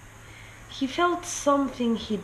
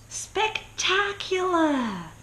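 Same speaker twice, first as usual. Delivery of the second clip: higher and slowly drawn out, in wonder; awed spectacular; 11 semitones higher, much slower than usual. A narrator's reading. Narrator marvelling at the dressed-up king, high and slow. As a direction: stretch the word high like you're dazzled.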